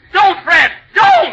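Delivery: high, loud panicked scream; terrified shout. Acted. Begging Fred not to shoot, shrill screaming shout. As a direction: scream to stop the gunman.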